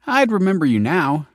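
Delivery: a lightly voiced flirty grin; grinning tease.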